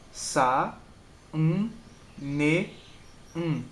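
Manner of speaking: "sa, um, ne, um" as four detached beats; separated syllables. Shown as a demonstration. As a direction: pause after each syllable of "sa, um, ne, um". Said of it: "as sílabas são separadas".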